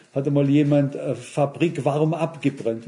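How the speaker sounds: ordinary delivery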